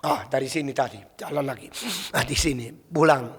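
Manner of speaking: a soft, low sniffing dog voiced lightly; a hint of a dog thinking aloud; dog's inner voice. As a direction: mutter as a dog tracking its scent.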